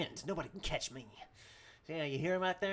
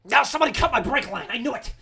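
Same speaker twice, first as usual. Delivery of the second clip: high, loud mock outrage; outraged shout; 7 semitones higher, 14 dB louder than usual. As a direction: shout an accusation in character. Outraged after the crash.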